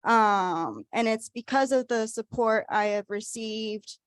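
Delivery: ordinary talk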